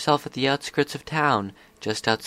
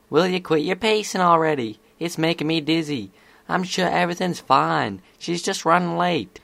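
higher-pitched, impatient filly; Apple Bloom, impatient; 6 semitones higher than usual. Acted. Apple Bloom telling pacing Scootaloo to stop, impatient. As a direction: chide your pacing friend in a high voice. Voices an impatient young friend.

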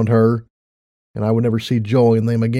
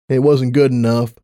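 ordinary delivery

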